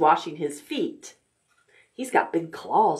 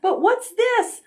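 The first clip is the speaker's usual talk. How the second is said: much higher, startled question; mock surprise. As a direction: sound suddenly surprised for the child. Surprise in the story.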